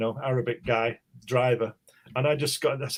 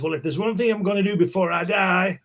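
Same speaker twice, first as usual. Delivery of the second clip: put-on older American stranger; 6 semitones higher than usual. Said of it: "He says, he says".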